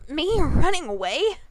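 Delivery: shouted. A narrator's reading. Pegasus denies running away, loud shout. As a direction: hurl the question back. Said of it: "Rainbow Dash shouted".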